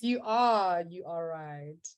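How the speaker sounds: usual speaking voice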